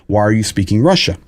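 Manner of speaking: normal talking voice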